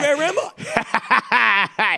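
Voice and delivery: Where am I stretched out very slowly through laughter; slow laughing daze. Acted. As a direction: laugh it out, dragging each word. Groggy drunk wake-up bit.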